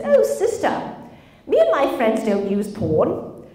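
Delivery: loud, put-on British greeting; bad British accent. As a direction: do a badly faked British accent. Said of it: "That's my bad British accent".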